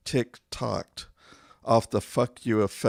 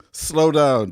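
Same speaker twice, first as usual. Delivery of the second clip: exasperated command; 6 semitones higher, 8 dB louder than usual. Acted. Nagging a reckless driver.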